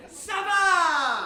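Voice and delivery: loud, high call with a laugh; shouted Ça va. Shown as a demonstration. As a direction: egg the crowd on with a big shout. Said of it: "I'm gonna shout".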